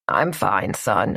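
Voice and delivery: low, lightly voiced elderly dad; old father voice.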